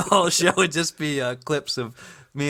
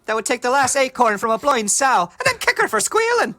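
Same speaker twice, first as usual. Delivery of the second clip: loud, high mock-indignant insult; put-on character voice; 8 semitones higher, 6 dB louder than usual. Teasing co-host over the joke, hammed-up outraged voice. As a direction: climb louder and higher into comic outrage.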